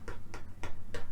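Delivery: "P, P, P, P" as bare puffs without voice; voiceless P. Shown as a demonstration. Teacher showing no throat vibration, voiceless P pops. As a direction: pop "P" with the lips only, no vibration. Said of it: "there's no vibration".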